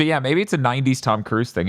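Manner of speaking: ordinary talk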